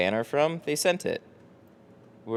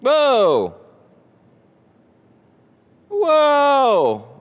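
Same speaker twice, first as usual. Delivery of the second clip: very high, loud, long "whoa"; real surprise; 10 dB louder than usual. Real, not acted. Streamer sees a big donation, loud high surprised whoa. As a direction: let out a stunned, soaring whoa.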